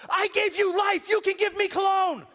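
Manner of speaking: mock angry dad. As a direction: play the indignant father. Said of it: "getting mad at him".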